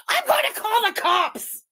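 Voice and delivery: high, drawn-out mocking yell; mock threat. Streamer mimics her rival's threat to call police, mocking yell. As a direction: wail it slowly as a mock threat.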